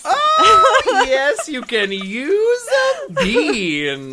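squealing with delight, high and loud; thrilled, drawn out slowly; excited. Real, not acted. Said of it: "I'm just so excited about the bean".

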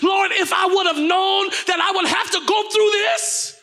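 high, impassioned sermon shout; full-force preaching. Real, not acted. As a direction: drive it home to the congregation, pitched high.

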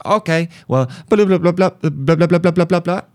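high blah-blah gibberish; mock rapid chatter. Cashier giving up and rattling on, high mock chatter. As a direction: rattle off nonsense like a foreign language.

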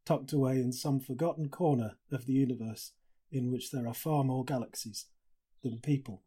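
hushed, awed reading of a passage; reading aloud. Shown as a demonstration. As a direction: let the words settle, quiet and moved. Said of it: "just wanted to read to you".

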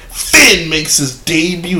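slow, drawn-out mock announcement. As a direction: read it out slowly like a sneering headline. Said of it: "it's like".

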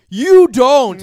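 sudden loud high shout; shouting capitalized words. Acted. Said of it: "I'm screaming because it's in caps".

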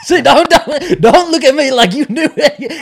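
loud, high, through laughter; laughing protest. Real, not acted. Teasing co-host who pretends they knew it, laughing loudly. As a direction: protest teasingly while cracking up.

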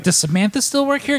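lightly voiced naive traveller; mock innocent question. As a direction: play dumb in a sugary high voice. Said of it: "you're like".

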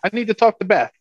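high-pitched imitation of broken English; mock broken-English voice, loud at first; broken-English aunt. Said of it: "speaks broken English".

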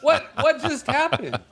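high, slow, laughing what just happened; mock bewilderment. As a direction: play dumb innocently. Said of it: "feigning surprise".

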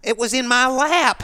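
loud, high-pitched exasperation; exasperated outburst. Real, not acted. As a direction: cry out over the win that slipped away. Exasperated over a race thrown away.

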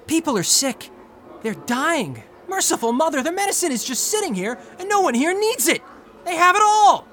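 desperate pleading. Acted. Wheelchair user begging the clerk for the serum, desperate. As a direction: beg her, climbing to a cry.

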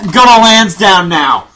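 put-on slow, loud Boston townie accent. Townie still playing to Sully, Boston accent. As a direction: drag it out in broad Boston. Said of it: "just do the accents again".

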